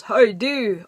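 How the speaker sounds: a clumsy Yorkshire "How do?"; awful Sean Bean Yorkshire. Retold or imitated. Retelling the running joke, mock Yorkshire accent. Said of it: "That's an awful Yorkshire accent".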